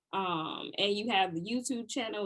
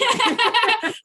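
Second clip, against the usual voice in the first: a burst of high, loud laughter; 14 semitones higher, 12 dB louder than usual. Real, not acted. Host cracks up at the tea time remark, loud high laughter. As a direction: cackle loudly at the joke.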